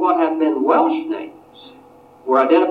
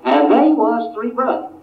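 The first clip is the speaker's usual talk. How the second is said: Appalachian folk storyteller, higher, rising on they; Appalachian dialect quote; 8 semitones higher than usual. Retold or imitated. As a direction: tell the family legend in Appalachian dialect. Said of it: "in that dialect".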